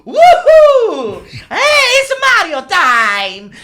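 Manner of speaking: high, loud whooping Mario; slow, shouted cartoon plumber cheer; hammed-up Mario catchphrase; Mario impression. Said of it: "Mario sounds like".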